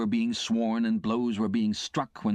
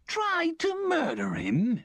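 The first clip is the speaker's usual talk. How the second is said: slow, high scoffing echo of the charge; disdainful; much slower than usual. A narrator's reading. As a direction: sneer the words back at him in slow contempt. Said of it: "said my convict disdainfully".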